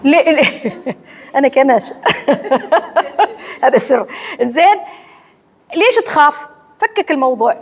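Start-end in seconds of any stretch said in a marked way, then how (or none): 2.0-3.3 real laugh. Real, not acted. Teacher laughing with students at her own example. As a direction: let a genuine laugh escape mid-lesson.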